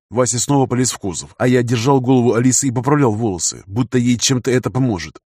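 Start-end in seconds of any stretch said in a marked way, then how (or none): none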